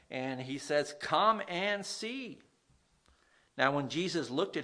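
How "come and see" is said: slow, drawn-out, higher invitation; an unhurried invitation to come look; inviting come and see. Acted. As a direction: draw each word out gently, beckoning. Andrew invites Peter, slow and drawn.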